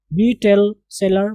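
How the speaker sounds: Beetle seller said very slowly; slow careful pronunciation. Shown as a demonstration. Teacher to class, beetle seller slow and clear. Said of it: "आप इसको battle नहीं पढ़ेंगे".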